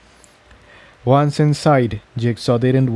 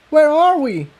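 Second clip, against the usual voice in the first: high shout over the noise; a high, alarmed call; shouting over explosions. A narrator's reading. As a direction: make yourself heard over the rumble. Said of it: "Tiptoe shouted over the muffled sounds".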